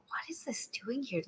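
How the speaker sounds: hushed, voiceless question; whispering. Retold or imitated. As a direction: whisper it in disbelief. Puzzled friends at the Mustang.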